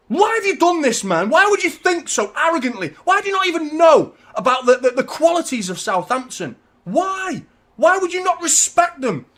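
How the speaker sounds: high, loud shouting at the manager; furious rant. Real, not acted. Fan shouting at Pep over team selection, furious high rant. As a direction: yell your outrage at Pep. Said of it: "I'm fucking fuming".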